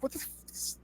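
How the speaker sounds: whispered, barely audible curse; a muttered what the f- whisper; under my breath. Retold or imitated. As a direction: swear to yourself so she can't hear. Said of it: "under my breath".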